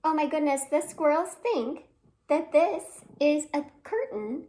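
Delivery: plain reading voice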